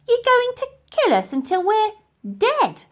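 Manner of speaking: put-on high, loud old-lady squeak. Dotty backing up the girl, high loud squeak. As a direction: chirp it in high and late.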